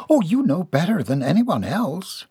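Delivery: excited, voice rising. Acted. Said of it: "Missus Albright's voice rose ... particularly excited".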